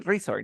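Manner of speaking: resort said REE-sort, as a place name; front-stressed resort; stress on first syllable. Shown as a demonstration. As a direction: put the force at the start of resort. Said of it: "como hay un lugar que le llaman".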